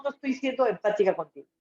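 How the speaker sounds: ordinary talk, nothing marked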